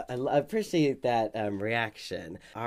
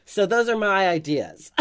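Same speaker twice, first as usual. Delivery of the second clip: laughing; 5 dB louder than usual. Real, not acted. Host laughs at own pun.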